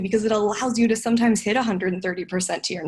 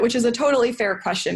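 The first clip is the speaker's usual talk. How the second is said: ordinary talk, nothing marked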